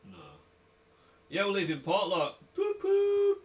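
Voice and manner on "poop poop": high horn toot. Acted.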